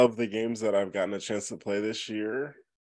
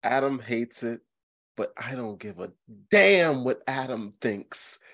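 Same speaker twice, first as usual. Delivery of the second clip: ordinary talk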